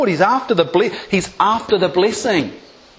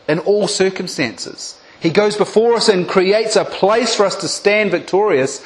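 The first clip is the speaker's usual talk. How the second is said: normal talking voice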